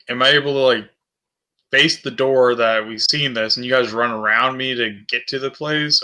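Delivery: ordinary talk, nothing marked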